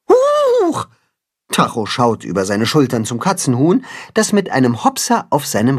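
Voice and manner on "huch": startled yelp. Acted. Startled as Timme climbs on.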